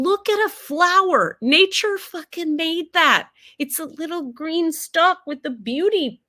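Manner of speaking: high, loud, wonder-struck gushing; rising into an awed, high-pitched rave; awestruck gushing. Real, not acted. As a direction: marvel out loud at the flower, voice soaring up. Rhapsodizing in awe about a flower.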